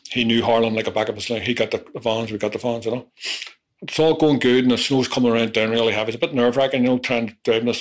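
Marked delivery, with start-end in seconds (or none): none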